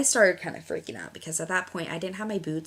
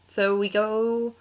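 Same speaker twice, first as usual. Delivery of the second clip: ordinary talk, nothing marked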